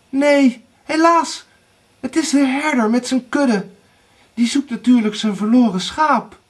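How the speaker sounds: high, loud report; lookout sister calling. A narrator's reading. Anna reports a shepherd to Erika, calling high. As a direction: call down the disappointing news. Anna calls down bad news.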